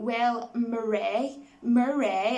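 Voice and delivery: "Murray" drawn out with a stretched last syllable; mock-formal "Murray" ending in a long "ay"; imitating odd name pronunciation. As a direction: stretch "Murray" slowly, leaning on the ending as "Mur-ray". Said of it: "it was just the way he was saying Murray".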